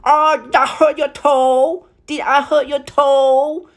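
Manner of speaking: high clucking falsetto fuss; high-pitched mock concern. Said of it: "clucking and being like".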